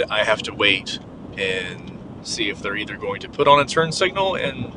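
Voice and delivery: usual speaking voice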